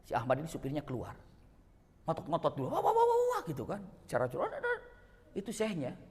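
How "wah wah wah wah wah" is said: mock protest. Driver protesting after crash.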